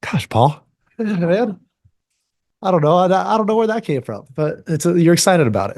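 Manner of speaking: real emotion welling up mid-answer; choked up. Real, not acted. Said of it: "you're making me cry".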